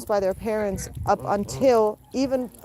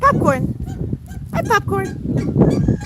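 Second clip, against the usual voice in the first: much higher soothing coo, drawn out; high pet talk; 12 semitones higher, slower than usual. Real, not acted. Owner calming the scared rooster Popcorn, with a high cooing voice. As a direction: coax the frightened rooster gently.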